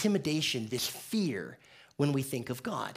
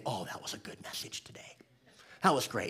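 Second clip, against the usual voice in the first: mock churchgoer compliment; 5 semitones lower, 8 dB quieter than usual. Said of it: "everybody pretty much said the same thing".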